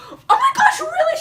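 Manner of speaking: very high, loud imitation of a thrilled mom; hammed-up excited gasping reaction; super excited. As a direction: squeal it like an overjoyed mother. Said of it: "She's, like, super excited".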